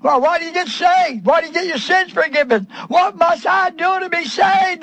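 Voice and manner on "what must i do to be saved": high pleading question; the jailer pleading, pitched up; jailer's plea. Retold or imitated. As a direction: ask it anxiously. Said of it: "the jailer said".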